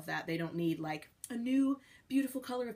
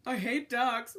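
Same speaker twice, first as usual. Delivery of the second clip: mock grumpy; 5 semitones higher, 5 dB louder, much slower than usual. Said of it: "And I'm like".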